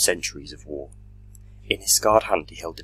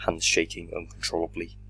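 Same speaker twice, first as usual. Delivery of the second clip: plain reading voice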